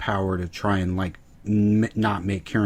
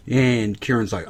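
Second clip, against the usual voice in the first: normal talking voice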